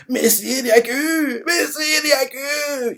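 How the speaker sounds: high, drawn-out mock wail; tearful cry. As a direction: wail it out as grieving underlings, hammed up. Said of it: "Ils crient ça en pleurant".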